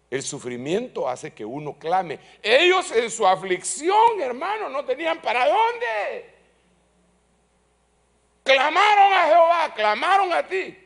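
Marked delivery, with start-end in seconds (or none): 2.4-10.8 loud, high preaching cry; preaching at full force. Acted. Preacher crying out to the congregation about their affliction, loud and high. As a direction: preach it out with fire.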